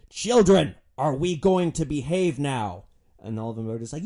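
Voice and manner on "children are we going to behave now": loud, slow stern scolding; roaring over noise. Retold or imitated. Driver roaring over wind at unruly passengers, stern. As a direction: boom it like a strict parent. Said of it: "I just roar over this noise".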